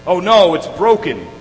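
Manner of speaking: mock grumble of parental disgust; retold exasperated grumble. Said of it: "grumbled through his clenched teeth".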